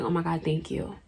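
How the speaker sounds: very soft, grateful murmur; hushed relief. Retold or imitated. Teen to lady officer, softly relieved thanks. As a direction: let the thanks out quietly, barely voiced. Relieved at being taken home.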